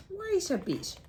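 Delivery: the echidna halting Dingo, high and prickly; bristling echidna. Acted. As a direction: bristle and stop them short. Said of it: "he bristled".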